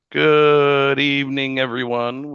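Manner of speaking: long, drawn-out "good evening"; host's greeting. Real, not acted. Host welcoming the stream audience, drawn-out greeting. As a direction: open the show loud, stretching the words.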